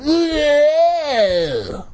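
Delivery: very high, long mock wail, falling off; mock-horrified shriek. Reader capping a chapter cliffhanger, mock shriek. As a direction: shriek it, then slide down.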